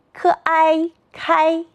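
"科哀开" slowly chanted high and level; slow first-tone blend "科哀开"; pinyin blending. Shown as a demonstration. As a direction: sound out "科哀", then join as "开" on one high note. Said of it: "自己也来读读吧".

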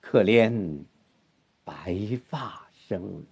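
very slow, soft verse with long pauses; solemn, stirring grief. Shown as a demonstration. Teacher models tragic grandeur for student, slow solemn grief. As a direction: hold 白发 long, grieving with dignity. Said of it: "这就没有一个悲壮的感觉了".

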